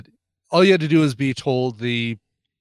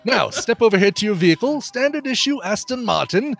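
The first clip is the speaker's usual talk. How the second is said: raised, fussy, exasperated quartermaster; John Cleese as Q; 4 semitones higher than usual. Acted. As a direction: primly lecture the careless agent. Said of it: "I'm doing the John Cleese".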